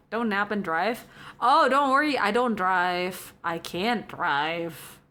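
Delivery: ordinary talk, nothing marked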